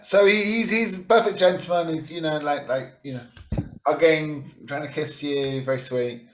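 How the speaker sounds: loud, high, excited rush; gushing. Acted. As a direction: hype up how sweet he is. GM gushes over the drunk captain.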